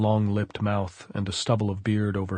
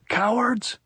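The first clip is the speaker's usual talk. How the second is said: shouted insult; 14 semitones higher than usual. A narrator's reading. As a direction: fling the insult. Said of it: "he shouted".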